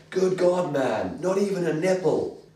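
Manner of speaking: high, loud mock outrage; hammed-up scandalized outburst; old-school comic-book narrator. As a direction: exclaim it as an appalled narrator. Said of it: "the narration just goes".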